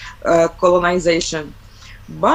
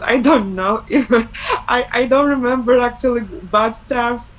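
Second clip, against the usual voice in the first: laughing; 5 semitones higher than usual. Real, not acted. Laughing off bad memories.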